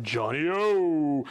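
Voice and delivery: slow JOHNNY O with a long O; rolling drawn-out name. Shown as a demonstration. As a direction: savor Johnny O, stretching the last O. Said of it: "rolls off the tongue".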